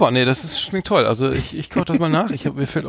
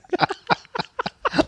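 lautes Lachen. Real, not acted. Lachen über Rezept.

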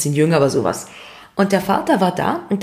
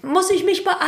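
high mock-innocent; 9 semitones higher than usual. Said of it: "sagt die andere Frau".

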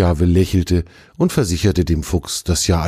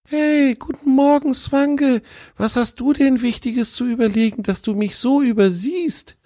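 put-on much higher young deer calling out; calling fawn. A narrator's reading. The fawn calls after the fox who walks past, high call. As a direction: call out to a friend, high and bright. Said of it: "rief Tjawe dem Freund zu".

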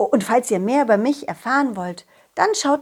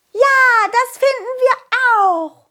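high, loud cheering fairies; little fairies calling out together; high fairy chorus; 12 semitones higher, 6 dB louder, slower than usual. Acted.

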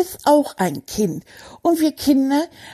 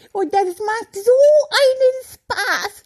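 laughing with joy; 11 semitones higher than usual. Acted. Raving about holiday fun.

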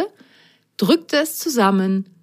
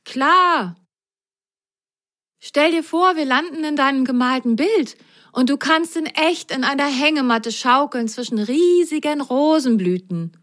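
higher, bubbly coaxing; encouraging; 8 semitones higher than usual. Acted. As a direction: talk your friend into it brightly. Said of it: "ermunterte Grasgrün seine Freundin".

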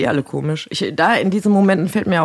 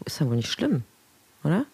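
ordinary talk

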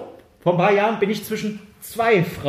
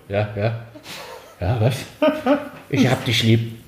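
low, drowsy half-asleep dad; father woken from his nap answering the phone, low and drowsy; sleepy father; 6 semitones lower, slower than usual. Retold or imitated. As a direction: mumble it like a dad just woken up.

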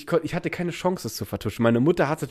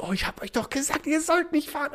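high, soft mock mother scolding; 11 semitones higher, 7 dB quieter than usual. Mother scolding the kids for riding, high mock scold. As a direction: imitate a nagging mom.

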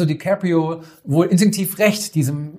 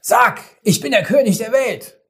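calling out over walkie-talkie; 7 semitones higher, 3 dB louder than usual. Retold or imitated. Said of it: "rief DiCaprio zu über ein Walkie-Talkie".

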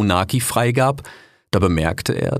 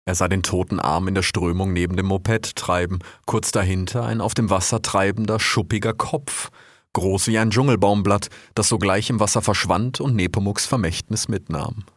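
ordinary delivery

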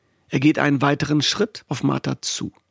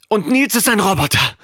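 quick, higher, louder blurt; 6 semitones higher, 4 dB louder than usual. A narrator's reading. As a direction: fire it after her. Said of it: "schießt Ayas hinterher". (schnell hinterhergeschossen, höher und lauter)

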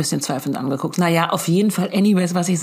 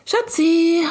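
sugary mom voice; 11 semitones higher than usual. Retold or imitated. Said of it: "wie meine erste Woche aussah".

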